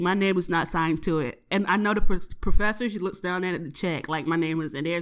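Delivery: normal talking voice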